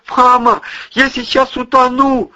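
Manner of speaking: high loud panicked cry for mama; terrified cry. A narrator's reading. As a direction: scream it in sheer terror. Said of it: "с парализующим ужасом понял Димка".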